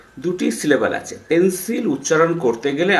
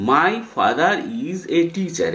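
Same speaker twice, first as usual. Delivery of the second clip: slow careful English; 3 semitones higher, much slower than usual. Shown as a demonstration. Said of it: "এখানে কীরকম উচ্চারণ হবে?".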